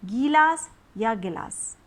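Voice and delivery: slow गीलास with long gee, then गिलास with short gi; slow contrast. Shown as a demonstration. Teacher closes with slow long-short comparison for kids. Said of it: "आप दो बार बोलेंगे".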